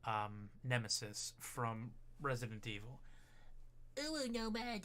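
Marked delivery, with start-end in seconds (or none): 4.0-4.8 high cute uwu voice; cutesy baby talk. Shown as a demonstration. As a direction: squeak it like a kitten.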